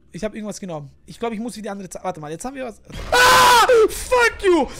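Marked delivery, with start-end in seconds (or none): 3.1-4.7 high loud scream then laugh; terrified scream. Real, not acted. Jump scare.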